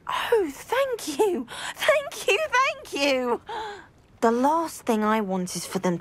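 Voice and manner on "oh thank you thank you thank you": overwhelmed thanks. Acted. Overjoyed at offer of help.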